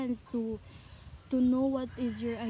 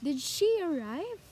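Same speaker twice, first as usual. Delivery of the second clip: "Did she arrive" lifting on "arrive"; rising intonation; 4 semitones higher than usual. Shown as a demonstration. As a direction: ask "Did she arrive" as a yes-or-no question. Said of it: "Rising intonation".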